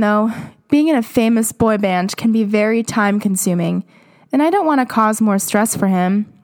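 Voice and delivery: normal talking voice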